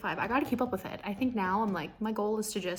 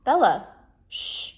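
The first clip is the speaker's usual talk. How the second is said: calling the dog; 7 dB louder, slower than usual. Real, not acted. Shushing her dog mid-vlog.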